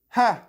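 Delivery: loud, high single mock laugh; scoffing "Ha!". Host answering whether she is crazy, loud scoff. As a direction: burst out with a scornful ha.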